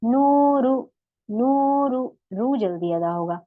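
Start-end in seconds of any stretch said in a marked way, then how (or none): 0.0-2.0 नूरु with the रू held two counts, loud; madd demo. Shown as a demonstration. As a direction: stretch the रू in नूरु. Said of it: "तो हम इसे पढ़ेंगे कैसे?".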